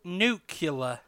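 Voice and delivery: "Nuclear" said as NOO-kyuh-ler; "Nuclear" with the syllables swapped into nu-cu-lar; nucular mispronunciation. Shown as a demonstration. Showing his co-host the wrong way to say "Nuclear". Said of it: "what's the other way".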